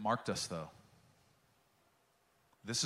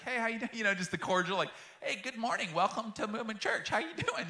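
much higher, chirpy greeter voice; mock-cheery welcome to a stranger at the door; lame greeter; 11 semitones higher than usual. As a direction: play the overly cheery church greeter. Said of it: "that lame guy".

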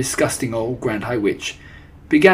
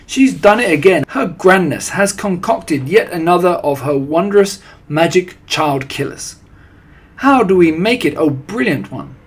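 high, loud gushing followers; adoring witches' cry; 6 semitones higher, 7 dB louder than usual. A narrator's reading. Witches praising their brilliant leader, excited and adoring. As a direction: cry out in thrilled worship. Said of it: "they cried".

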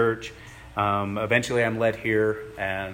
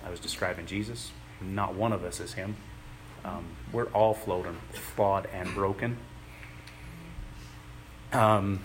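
ordinary delivery

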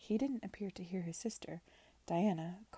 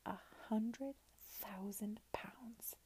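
softer, slow and reverent; breathed reverently; 2 semitones higher, 6 dB quieter, much slower than usual. A narrator's reading. Chaperone whispers the fortune to Lady Denham, slow and reverent. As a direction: hush it like a sacred sum. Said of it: "she breathed in reverent tones".

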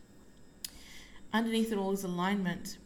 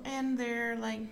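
usual speaking voice

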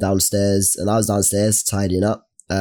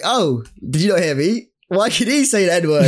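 mock rude woman; 7 semitones higher than usual. Imitating the rude customer in the shoe shop, higher and snippy. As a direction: throw the question back with sneering disbelief. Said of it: "she says it again".

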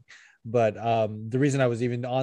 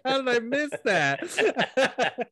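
laughing; 12 semitones higher, much slower than usual. Retold or imitated. Laughing retelling, high and slow.

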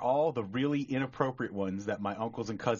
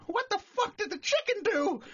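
very high squawked bird voice; parrot punchline. Acted. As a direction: screech the question like a stunned parrot. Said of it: "the bird's like".

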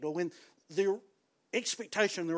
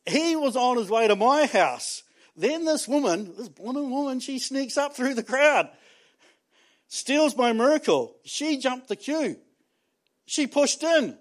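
raised, aggrieved voice, pleading his case; Jairus complaining; 5 semitones higher than usual. Acted. Jairus to the congregation, higher, indignant complaint. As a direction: play the wronged father who got there first. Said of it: "he could have thought, Jairus".

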